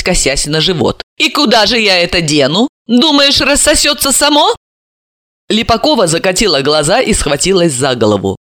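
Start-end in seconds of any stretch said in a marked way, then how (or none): 1.2-4.5 higher, amused questioning; giggly teasing question. A narrator's reading. Pregnant daughter needling her mother, amused. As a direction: tease her with a rising question. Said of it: "Сима с хихиканьем осведомилась".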